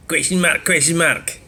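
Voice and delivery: mocking "question mark"; mock troll voice. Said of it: "I'll do it".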